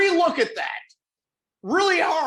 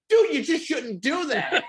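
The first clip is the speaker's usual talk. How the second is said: laughing scold; 10 semitones higher than usual. Retold or imitated. Mocking the cops, laughing.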